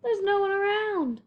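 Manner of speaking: a hint of a curious elephant; lightly voiced Horton. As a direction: Horton wondering aloud. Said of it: "thought Horton".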